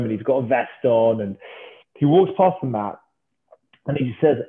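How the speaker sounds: ordinary talk, nothing marked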